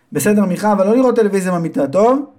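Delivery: high, fast motherly warning; fussy mother. Acted. Mother warns her son quickly, high-pitched. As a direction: rattle off a mother's rule.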